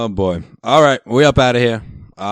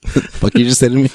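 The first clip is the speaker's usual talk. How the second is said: laughing mock retort; 5 semitones higher, 4 dB louder than usual.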